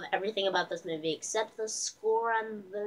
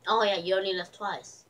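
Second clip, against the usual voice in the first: ordinary talk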